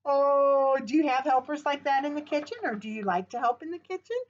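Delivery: normal talking voice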